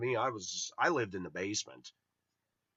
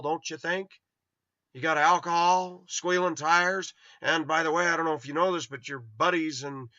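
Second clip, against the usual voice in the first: raised, insistent telling-off; retold scolding; 4 semitones higher, 5 dB louder than usual. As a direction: re-enact dressing down a neighbor, pitched up. Retold argument scolding the neighbor.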